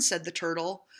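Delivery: ordinary delivery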